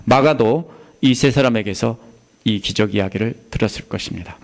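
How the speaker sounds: ordinary delivery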